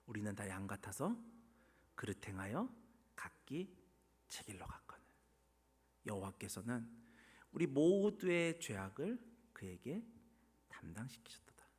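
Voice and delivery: low, soft reading with spaced phrases; solemn scripture reading. Real, not acted. Preacher reading Isaiah on sins laid on him, solemn. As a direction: read it hushed, phrase by phrase. Reads Isaiah verse aloud.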